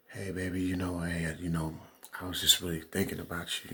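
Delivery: soft, hushed crooning to a lover; sweet baby voice. Retold or imitated. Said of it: "you want him to sweet talk to you like".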